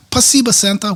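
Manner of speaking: high-pitched imitation of a grateful child; little Russian girl. Little Russian girl thanking Santa, imitated high voice. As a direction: lift the voice way up like a small girl. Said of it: "after that, she says".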